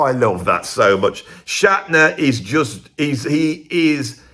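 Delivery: usual speaking voice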